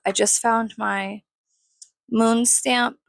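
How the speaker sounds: normal talking voice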